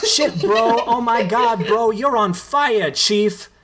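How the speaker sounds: high, gushing bro hype. Acted. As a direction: rave at him like he just won the game. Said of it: "Tito gushes to Richie".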